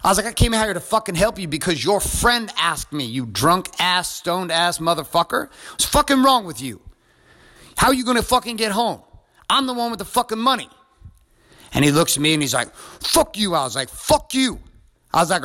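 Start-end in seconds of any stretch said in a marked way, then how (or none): 0.3-10.7 indignant tirade in a raised pitch; retold angry scolding. Acted scolding in retold quarrel. 12.8-13.6 higher-pitched mock belligerence; retold angry insult. Acted insult in retold quarrel. 13.9-14.6 retold angry retort. Acted retort in retold quarrel.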